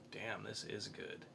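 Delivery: ordinary talk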